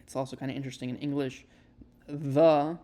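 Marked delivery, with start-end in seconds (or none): 2.1-2.8 "the" with voiced th, tongue between teeth; voiced th demo. Shown as a demonstration. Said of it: "it doesn't make a th".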